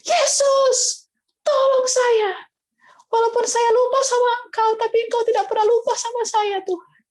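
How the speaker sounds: high, loud desperate scream for help; screaming to Jesus for rescue, high and loud; desperate cry. Retold or imitated. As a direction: cry out to Jesus at the top of your voice. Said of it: "saya langsung teriak".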